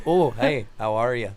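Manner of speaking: a bad, half-hearted try at an Ohio greeting; Ohio accent. Mocking Ohio locals to friends, weak Ohio accent. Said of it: "I can't do it".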